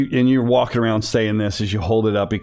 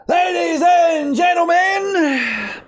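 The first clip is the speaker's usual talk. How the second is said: high, slow booming announcer call; ring announcer; 4 dB louder, much slower than usual. Acted. Ring announcer introduces fighters.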